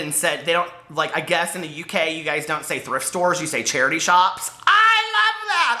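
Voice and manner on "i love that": very high, drawn-out gush of delight; excited squeal. Real, not acted. Gushing to viewers over charity shops, high excited squeal. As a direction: squeal it out, stretching the words.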